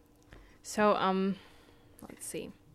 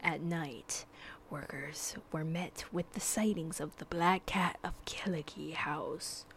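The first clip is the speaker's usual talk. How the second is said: hushed, breathy spooky whisper; creepy campfire whisper; 3 semitones lower, 6 dB quieter than usual. Acted. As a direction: whisper it like a ghost story.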